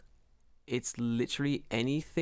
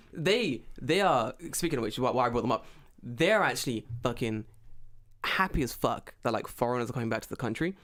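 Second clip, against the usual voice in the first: usual speaking voice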